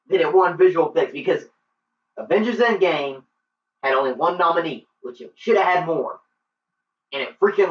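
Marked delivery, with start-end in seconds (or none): none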